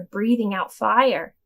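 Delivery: ordinary delivery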